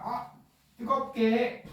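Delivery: playful mock command; whiny demand. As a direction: demand it like a spoiled boss. Liver demands heat.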